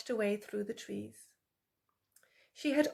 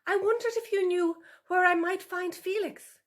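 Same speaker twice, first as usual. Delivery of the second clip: higher, careful, polite fox voice; polite vixen voice; 9 semitones higher, 6 dB louder than usual. A narrator's reading. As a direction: Tula asks the wary sow politely, higher pitched. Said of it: "Tula said".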